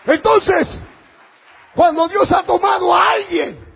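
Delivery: loud, high sermon voice; fiery preaching. Acted. Pastor warns his congregation, fiery preaching. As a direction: preach it out at full force. Preaching continues at full force.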